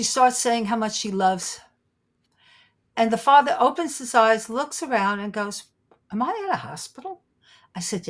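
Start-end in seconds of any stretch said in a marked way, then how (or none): 6.1-7.2 soft, higher-pitched question; small, puzzled voice of someone just come to; bewildered waking patient. Retold or imitated. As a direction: ask it softly, dazed from waking.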